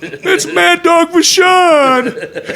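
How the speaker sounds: very loud, high shout, last words stretched; starstruck outburst. Retold or imitated. Kid tells dad who he's sitting by, shouting starstruck. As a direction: yell it like a kid spotting his idol.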